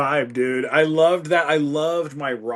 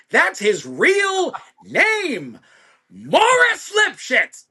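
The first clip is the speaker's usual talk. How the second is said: high, blustering big-ego producer, climbing higher; Gustavo; 8 semitones higher, 3 dB louder than usual. Acted. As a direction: boast indignantly, building to a loud, drawn-out squawk. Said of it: "record producer with a giant ego".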